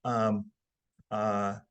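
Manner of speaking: slow, low, stalling fillers; faltering slowdown. Shown as a demonstration. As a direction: lose your flow and stall on drawn-out ums. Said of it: "slow down like that".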